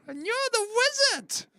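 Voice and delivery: very high squawky bird voice; raven voice. Acted. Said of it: "evil raven voice".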